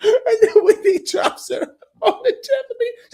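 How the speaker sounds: very high, loud laugh-cry while talking; helpless with laughter at the memory; laughing hard. Real, not acted. Cracking up mid retelling.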